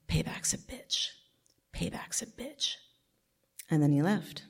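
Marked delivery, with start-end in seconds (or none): 0.1-2.8 slow, hissed menacing whisper. Retold or imitated. Stepfather threatening mom at the door, slow menacing whisper. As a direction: breathe the threat out slowly, no voice at all.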